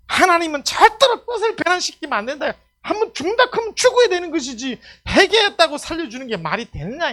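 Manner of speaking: high, loud, indignant pulpit delivery; ringing, heated sermon voice; full-force preaching. Acted. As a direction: argue it fiercely from the pulpit. Preaches Jonah's argument at full force.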